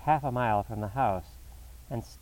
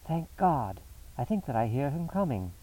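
urgent relief; 4 semitones higher than usual. A narrator's reading. Holmes hears the awaited man coming.

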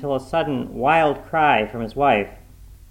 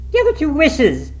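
high, loud excited answer; urgent reply; 7 dB louder than usual. A narrator's reading. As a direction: blurt it out urgently. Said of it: "she replied rapidly".

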